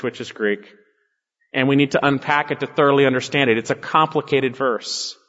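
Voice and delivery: ordinary delivery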